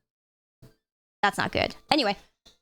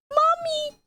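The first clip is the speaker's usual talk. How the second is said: put-on much higher excited child voice calling mommy; child begging mom and dad to go to a Pokemon event, high and eager; little kid begging. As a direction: squeal it like a small kid tugging at parents. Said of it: "I was like".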